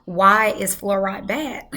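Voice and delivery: ordinary talk, nothing marked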